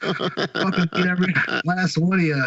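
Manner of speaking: high-pitched mock yell of a threat; Jesse yelling. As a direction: throw out the threat like an imitated street yell. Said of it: "he's yelling this".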